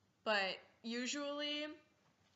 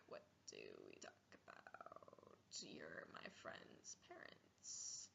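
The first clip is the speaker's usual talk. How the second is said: breathy unvoiced whisper, slowed; awkward whisper; 19 dB quieter, slower than usual. Teen stuck with friend's parents, whispering nervously. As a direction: whisper it under your breath, mortified.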